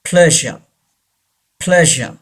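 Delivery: PLEASURE as PLEZH-uh, final R silent; R not pronounced. Shown as a demonstration. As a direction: model "pleasure" with no R at the end. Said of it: "आर को pronounce नहीं किया जाएगा". (pleasure में R silent, "प्लेज़अ")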